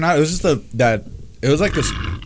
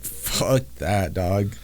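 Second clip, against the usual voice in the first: low grumble; 9 semitones lower, 12 dB quieter, much slower than usual. Retold or imitated. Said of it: "I was like".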